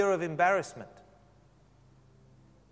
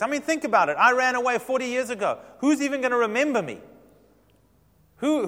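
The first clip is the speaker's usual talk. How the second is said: higher, louder acted objection; animated protest; 5 semitones higher, 5 dB louder than usual. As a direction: argue Moses' case with rising incredulity. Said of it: "But Moses protested again".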